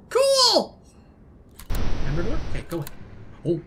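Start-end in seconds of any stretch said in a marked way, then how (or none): 0.1-0.8 loud, high, drawn-out delight; excited cheer. Real, not acted. Player thrilled to find a pirate, excited cheer.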